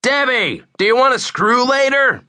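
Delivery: loud, high-pitched call across a street; hollering. A narrator's reading. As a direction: holler it brazenly over a crowd. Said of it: "I hollered".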